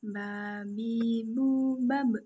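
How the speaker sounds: "ba, bi, bu" with lips pressed shut; teacher models the vowel set; letter ba drill. Shown as a demonstration. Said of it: "Coba perhatikan".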